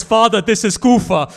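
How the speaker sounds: high, loud anguished cry to a father; pained lament. Acted. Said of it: "says something which was painful".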